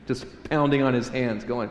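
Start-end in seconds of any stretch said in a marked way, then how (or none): none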